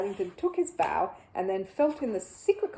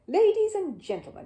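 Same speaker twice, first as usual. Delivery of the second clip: higher, louder showman opening; grand announcer bear; 10 semitones higher, 6 dB louder than usual. A narrator's reading. Bear announcing his magic show to the family, grand and showy. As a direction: announce it like a proud little magician.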